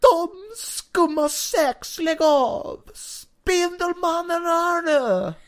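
high, loud, stretched-out shout; over-the-top cartoon announcement; dramatic hero call. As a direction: holler it slowly in a high put-on voice. Villains confronted by Spider-Man, called out.

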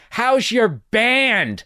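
old neighbor mocking his guitar playing, sarcastic. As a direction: drag it out with heavy sarcasm. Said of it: "It's sarcastic as hell".

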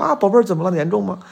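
lightly voiced doting TV husband; a hint of soap-opera tenderness; TV drama sweetheart. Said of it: "像电视剧里一样说".

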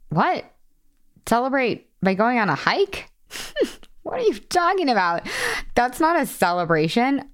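mock disbelief. Retelling her reaction to a friend's hike idea, incredulous mock disbelief. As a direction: act baffled, as if the idea were absurd. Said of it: "And I was like".

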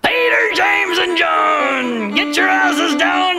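high, slow, shouted divine command; booming God voice. Pretend. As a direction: bellow orders from the heavens. Mock God commanding disciples.

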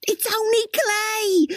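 high-pitched exasperated scream. A narrator's reading. Crystal screams at Dwayne about the pot, at the end of her patience. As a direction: shriek it out, stretching the words. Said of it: "screamed Crystal".

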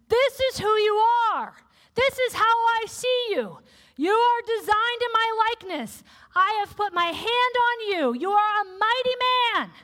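God's voice. Acted. God affirming Gideon, in a lifted proclaiming voice. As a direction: declare it over him like God himself.

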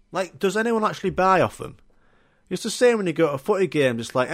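ordinary talk, nothing marked

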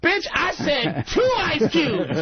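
slow, high furious yell; angry shout. Retold or imitated. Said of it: "as Voss goes".

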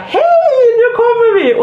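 acted excited hollering; cheery call-out. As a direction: call across the slope to friends. Calling to approaching friends.

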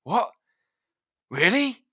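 high, loud mock-astonished dad; surprised dad. Dad amazed his son quit smoking, high mock surprise. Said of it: "he was just like".

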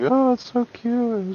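high, slow cooing voice; mock cooing. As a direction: coo like someone charmed by a baby. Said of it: "everyone was just like".

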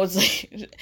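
usual speaking voice

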